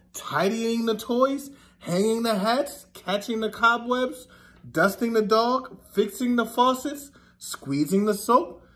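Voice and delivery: slow, sing-song picture book reading; story reading. Acted. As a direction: read it to the kids with a gentle rhythm. Reads picture book to children.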